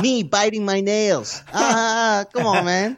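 high put-on Mexican accent, laughing through it. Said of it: "your Mexican accent is wonderful".

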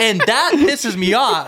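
high, slow, fed-up rant; exasperated. Real, not acted. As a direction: drag it out, really annoyed. Ranting, laughing partner eggs on.

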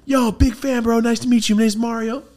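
high, loud, eager introduction; excited greeting. Retold or imitated. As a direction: greet him like a long-lost friend. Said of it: "And he's like".

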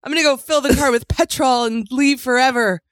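hamming up a Brit grumbling about petrol; playful British accent imitating the husband; mock British accent. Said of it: "or whatever they say".

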